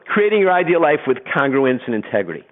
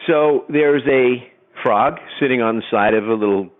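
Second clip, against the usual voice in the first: usual speaking voice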